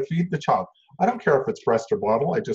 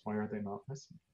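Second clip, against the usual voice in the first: ordinary talk, nothing marked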